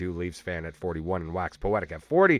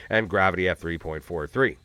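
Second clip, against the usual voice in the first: normal talking voice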